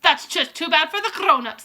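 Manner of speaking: high, loud dismissive witch; Grand High Witch. Acted. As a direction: brush it off coldly. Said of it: "said the Grand High Witch".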